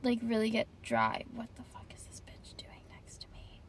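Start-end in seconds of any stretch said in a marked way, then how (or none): 1.3-3.6 hushed, breathy whisper at a stranger. Real, not acted. Muttering in her car about a nearby driver, whispering. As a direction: whisper it so they won't hear.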